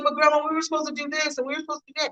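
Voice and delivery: high, fast whining protest; whiny teen. Retold or imitated. Granddaughter pleading with grandma, high and fast whining. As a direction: complain like a let-down teenager. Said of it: "she was like".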